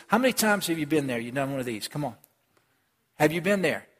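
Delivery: normal talking voice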